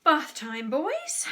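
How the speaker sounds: singsong call rising high; mum's cheery call. A narrator's reading. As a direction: summon the children loudly. Mum calling boys to bath.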